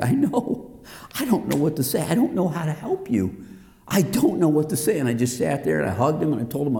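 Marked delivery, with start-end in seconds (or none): 0.0-5.0 high, pleading, mildly tearful; helpless comforting, pitched up; tearful helplessness. Retold or imitated. Man crying with his hurting friend, helpless. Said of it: "I couldn't stop crying".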